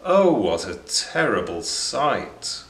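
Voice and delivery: plain reading voice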